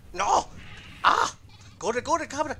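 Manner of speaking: being chased. Real, not acted. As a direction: scream at the goat to run. Dragon chasing the goat.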